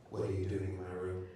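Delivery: low, soft, menacing phantom; ghostly voice in the ear. Retold or imitated. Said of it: "he heard a voice in his ear go".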